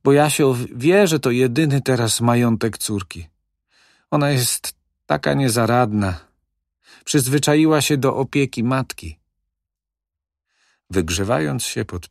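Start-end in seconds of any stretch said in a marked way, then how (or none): 0.0-9.2 lightly voiced fretful old woman, higher. A hint of the old widow fretting about her daughter. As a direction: fuss over the helpless daughter.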